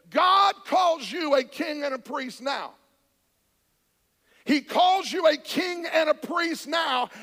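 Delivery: loud, driving pulpit proclamation; full-force preaching. Acted. Pastor booming a declaration to the congregation. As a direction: preach it out to the whole church.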